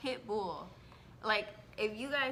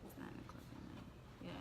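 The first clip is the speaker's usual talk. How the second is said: whisper; 15 dB quieter than usual. Real, not acted. Muttered aside.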